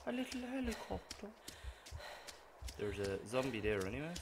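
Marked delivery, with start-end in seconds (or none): 0.1-1.3 high, lightly cutesy little voice. Pretend. Gamer cooing over a little helicopter, cutesy. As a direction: coo it like to a child.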